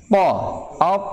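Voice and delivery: "Po" with a vowel after, then "op" ending closed with a bounce; po versus op. Shown as a demonstration. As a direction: contrast "Po" then "op". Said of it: "po atau ta itu".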